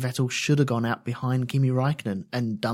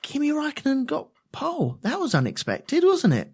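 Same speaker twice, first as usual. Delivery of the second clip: mock astonishment; 10 semitones higher than usual. Mock astonishment at Kimi's pole.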